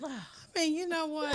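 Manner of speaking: loud, high burst of amazement; overwhelmed exclamation. Real, not acted. Host reacting to a guest's poem, overwhelmed. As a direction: exclaim it, bowled over.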